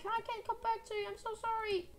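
high, pleading cry; desperate apology. Pretend. Player can't come back to teammate, desperate apology. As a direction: plead from far up in the voice.

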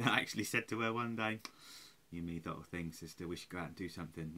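ordinary talk

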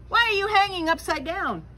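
high and loud, demanding to know. A narrator's reading. Stranger bat loudly questioning Stella Luna, loud and high. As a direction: call up at her, baffled and loud. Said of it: "a loud voice said".

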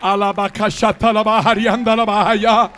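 rapid ecstatic babble of syllables; speaking in tongues. Real, not acted. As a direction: pour it out in tongues. Preacher breaks into tongues.

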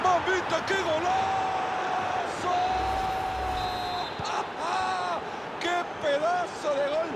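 screaming goal call, high pitch; breakneck goal commentary. Real, not acted. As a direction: belt out the goal with joy. Goal celebration shout.

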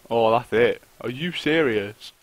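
ordinary delivery

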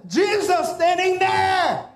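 high, drawn-out preacher's cry; climactic shout. Real, not acted. Preacher proclaiming that Mary saw Jesus, climactic shout. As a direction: shout it out, stretching the last word.

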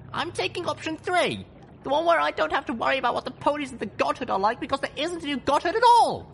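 put-on high, fast determined young mare; young heroine voice. A narrator's reading. Voiced heroine Little Pip.

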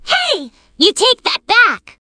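high, slow indignant protest; acted cartoon indignation; offended demand. Acted quarrel, insulted character demands retraction.

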